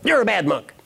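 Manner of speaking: high, emphatic mock scolding; hammed-up outrage, pitched high; angry monk. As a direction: burst out as the indignant monk. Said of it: "his colleague is still angry".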